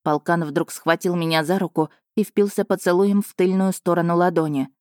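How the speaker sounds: plain reading voice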